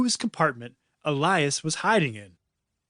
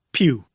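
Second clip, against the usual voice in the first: a louder, lower pretend laser shot; laser pew; 3 semitones lower, 5 dB louder than usual. A narrator's reading. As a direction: spit out a second pew. Kids mimic laser shots.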